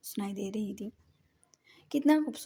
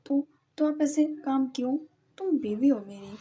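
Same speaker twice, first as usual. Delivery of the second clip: loud, indignant protest; a heated complaint to his wife; raised voice; 4 semitones higher, 8 dB louder than usual. A narrator's reading. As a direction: push back hard at her. Husband and wife quarrel.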